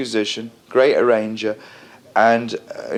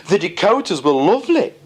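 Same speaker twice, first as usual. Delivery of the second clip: put-on higher, lightly posh English accent; 7 semitones higher than usual. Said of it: "in his nice posh accent".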